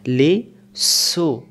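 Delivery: লিসু drawn out slowly with a whistling s sound, not ch; teacher stressing লিসু with a clean s; careful pronunciation. Shown as a demonstration. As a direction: say লিসু with a hissing স, never লিচু. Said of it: "লিচু না আবার".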